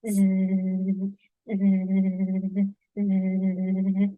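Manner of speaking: low buzzing like a bee; bee buzz imitation. Shown as a demonstration. Imitating a bee buzzing for the guessing game. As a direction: buzz it for the kids. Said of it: "suaranya begini".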